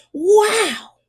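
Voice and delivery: high, bright gasp of wonder; a child amazed at a giant pumpkin; amazed wow. A narrator's reading. Taylor gasps at the huge pumpkin, amazed.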